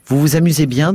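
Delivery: sarcastic exasperated question. Acted. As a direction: lash out, fed up and sarcastic. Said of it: "demandai-je excédé".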